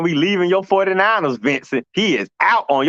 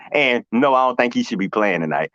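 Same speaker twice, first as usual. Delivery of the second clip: ordinary talk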